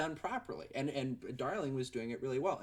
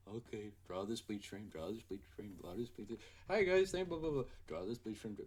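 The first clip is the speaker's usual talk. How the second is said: softer, low monotone repeating the task; robotic drone; 3 semitones lower, 3 dB quieter than usual. Retold or imitated. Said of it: "like a robot".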